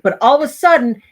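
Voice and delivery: ordinary talk, nothing marked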